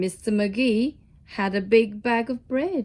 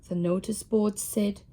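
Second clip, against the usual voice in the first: plain reading voice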